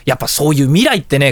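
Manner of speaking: usual speaking voice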